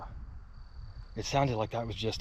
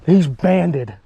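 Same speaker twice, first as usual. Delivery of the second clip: louder, higher exclaim; excited exclaim; 7 semitones higher, 16 dB louder than usual. Real, not acted. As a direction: blurt it out in delight. Discovers leg band.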